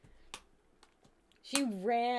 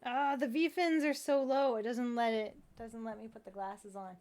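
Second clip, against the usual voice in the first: normal talking voice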